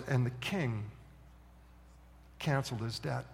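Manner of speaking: soft, low, with a long pause; hushed reveal. Acted. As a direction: drop the surprise outcome quietly. Storyteller delivers the surprise outcome.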